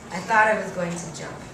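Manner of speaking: ordinary delivery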